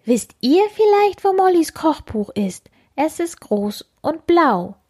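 eager fairy asking the bugs. Acted. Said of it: "beschreibt sie".

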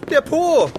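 high, loud cheeky punchline; cheeky outburst. Acted. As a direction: blurt it out gleefully. Joke about the sculpture.

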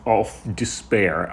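usual speaking voice

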